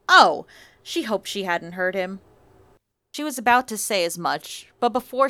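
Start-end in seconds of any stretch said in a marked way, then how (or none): 0.1-0.5 loud, sudden startled oh; the penny drops, loudly; surprised. A narrator's reading. Sudden realization in narration.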